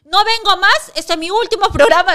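loud, high announcement of quitting; upset ultimatum. Real, not acted. Host announcing her last programme after being disrespected, upset.

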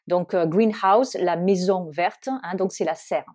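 normal talking voice

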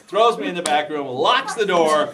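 loud comic build, last words stretched; mock alarm. Real, not acted. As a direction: punch it out big for the laugh. Comic repeat of being shut in.

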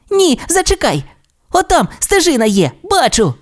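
high-pitched eager discovery; excited young dinosaur. Acted. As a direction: halt your friend, eager and high. Timmy spots a path.

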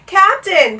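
loud high plea; pleading. A narrator's reading. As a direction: implore urgently. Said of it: "pleaded Bartholomew".

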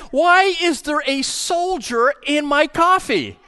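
high, slow, drawn-out question; bewildered dad. Acted. Dad finds a toy soldier in his coffee, bewildered. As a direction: demand an explanation, pitched well up.

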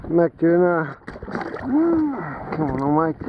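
normal talking voice

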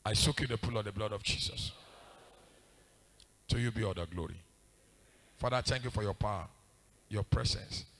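low, soft prayer murmured over someone; quiet prayer. Real, not acted. Preacher soaking them in the blood of Jesus, praying quietly. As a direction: pray it softly, low in the voice.

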